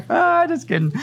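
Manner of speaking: high, laughing take-back; high amused retraction; laughing just kidding. Real, not acted. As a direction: undo the joke, still laughing. Takes back the joke.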